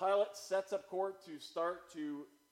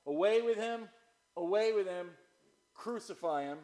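raised shout easing off by the end; crying out; 5 semitones higher, 8 dB louder than usual. Acted. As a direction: call it out like the crowd, then let it subside.

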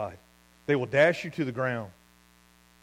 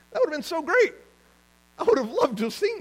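much higher, louder gush; excited; 5 dB louder than usual. Real, not acted. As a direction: bubble over with delight. Excited about praising rocks.

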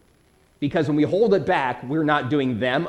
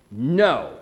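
low, decisive NO with a fall; emphatic no; 4 semitones lower than usual. Shown as a demonstration. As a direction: shut it down with one firm "No". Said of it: "which is an emphatic no".

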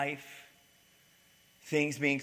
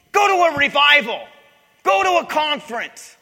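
much higher, much louder harsh shout; scolding pastor shouting; 14 semitones higher, 19 dB louder than usual. Retold or imitated. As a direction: bark orders at the broken man. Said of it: "So the pastor comes to show up".

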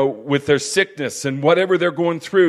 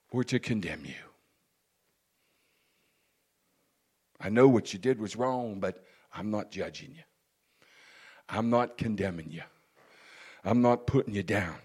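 soft, low, non-judging comfort; gentle reassurance; 4 semitones lower, 6 dB quieter than usual. Acted. Compassion telling the wrongdoer he is not judged, gently. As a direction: speak softly and low, letting each assurance settle.

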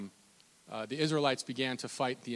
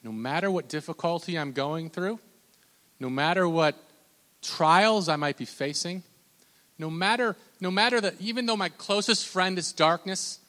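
raised, pleading prayer voice; impassioned prayer; 5 semitones higher, 7 dB louder than usual. Acted. Preacher voicing the psalmist's plea, loud and pleading. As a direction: cry it out to God from the pit.

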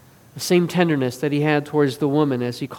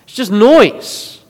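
exasperated rebuke, raised voice; challenging; 4 semitones higher, 7 dB louder than usual. Retold or imitated. Jesus confronts the noisy mourners at the house, challenging. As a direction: challenge them loudly to quit it. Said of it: "And he challenges them".

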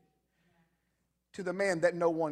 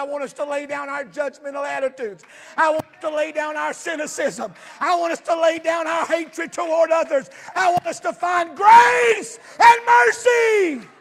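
building preacher's shout; 8 semitones higher, 14 dB louder than usual. Acted. Pastor pleading for his church to lay down hatred, building to a shout. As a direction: swell to a cry on grace and mercy.

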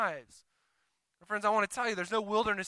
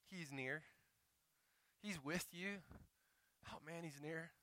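much softer, intimate and tender; hushed reassurance; 3 semitones lower, 18 dB quieter than usual. Real, not acted. Pastor tells the straying listener God is near, softly and tenderly. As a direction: lean in and soothe them.

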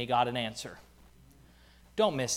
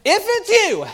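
much higher, louder wavering plea; mock-doubtful call; 7 dB louder than usual. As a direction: sound unsure and pleading, not assured at all. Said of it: "obviously he's real assured in his heart".

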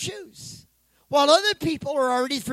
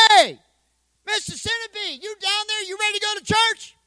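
impatient call; 10 semitones higher than usual. Acted. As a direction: shout down into the box, losing patience. Pastor calls a third time.

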